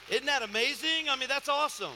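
loud, high burst of amazement; excited exclamation. Real, not acted. Preacher marvels to the congregation at his testimony, loud and excited.

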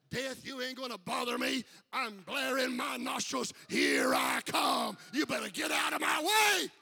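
loud defiant challenge to death; defiant Jesus. Acted. Jesus at the tomb daring death, loud and defiant. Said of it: "He said".

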